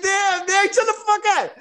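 high-pitched mock protest; retold exasperated plea. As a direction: beg a friend to ease off.

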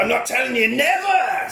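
hammed-up high defiant cry; lightly voiced defiant captive; defiant woman. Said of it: "And she's like".

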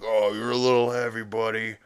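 a slight act of straining under a load; straining dragon. Retold or imitated. Falkor sinking under Peter's weight, mildly strained.

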